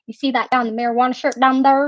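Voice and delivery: fast imitated backwoods Arkansas accent; quick, run-together imitation of a country accent; ridiculous country accent. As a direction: rattle it off in a mock Arkansas accent. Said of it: "He talked to me like that".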